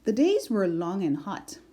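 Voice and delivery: plain reading voice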